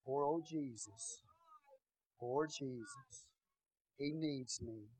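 soft, slow pitying voice; lightly hammed-up poor-Jesus sigh; mock pity. Crying altar-goer pitying Jesus, soft mock pity. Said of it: "No, they're praying".

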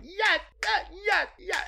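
high-pitched mock yelling, little extra volume; retold screaming. Viewer cheering at the television, retold screaming. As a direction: imitate screaming at the TV in short high bursts. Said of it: "I was screaming at the television".

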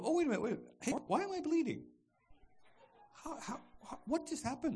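high, hurried mock alarm; startled alarm. Target noticing a passive-aggressive jab, high startled confusion. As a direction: suddenly pitch up in puzzled shock. Sudden realisation of being wounded.

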